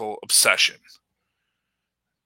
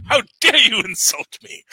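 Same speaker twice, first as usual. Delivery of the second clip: hammed-up affronted outrage; mock indignation; 6 semitones higher, 4 dB louder than usual. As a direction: pretend to be gravely insulted. Mock offended reply as the listener Ray.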